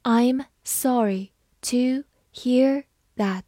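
slow, each word apart; slow model reading of the sentence. Shown as a demonstration. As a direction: read it slowly for learners. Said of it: "好，慢慢来读".

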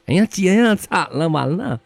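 high-pitched, panicky acted voice; nervous little sister. Little sister warns her sister about mosquitoes, nervous. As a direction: cry out in mock alarm. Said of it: "妹妹就很紧张地说".

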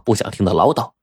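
ordinary delivery

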